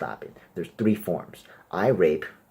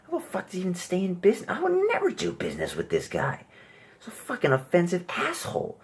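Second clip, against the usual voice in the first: higher, indignant offended viewer voice; mocking offended viewer; 12 semitones higher than usual. Comic taking the voice of viewers who hate him, higher and indignant. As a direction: play the outraged audience member, pitched up. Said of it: "you might be thinking".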